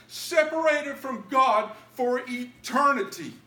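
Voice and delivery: high, loud, slow preaching, fully open; thundering revival preaching; full-force preaching. Acted. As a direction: preach it out to the back row.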